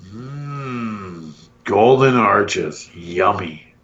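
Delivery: low, slow, dreamy "Mm, Golden Arches"; a lightly voiced craving for burgers; mock savoring.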